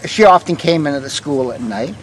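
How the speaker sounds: ordinary talk, nothing marked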